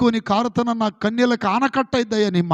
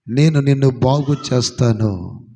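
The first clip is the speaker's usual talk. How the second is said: much lower, slow and weighty; low slow promise; 7 semitones lower, 5 dB louder, slower than usual. Acted. Preacher voicing God's promise to Hezekiah, low and slow. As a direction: let the promise sink low and heavy.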